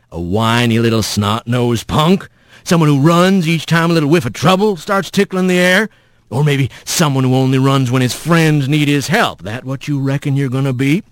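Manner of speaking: low folksy needling; taunting rough voice. Acted. Mysterious voice taunts runaway Rat, folksy and needling. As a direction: needle him like a backwoods elder.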